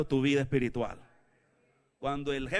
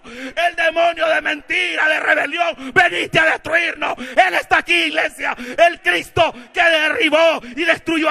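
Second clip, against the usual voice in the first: high, loud, drawn-out shouting; full-force preaching; 11 semitones higher, 12 dB louder than usual. Real, not acted. Preacher telling church Christ is here, full-force shouting. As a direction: keep shouting in rousing waves.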